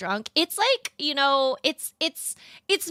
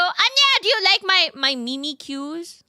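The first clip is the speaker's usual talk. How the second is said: excited; 7 semitones higher, 3 dB louder than usual. Real, not acted. Showing drawing to chatter.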